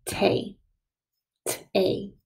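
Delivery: "Tay" sounded out by itself, then with the T apart; first syllable alone. Shown as a demonstration. Teacher helping pupils write the first syllable, sounded out. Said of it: "do the first syllable".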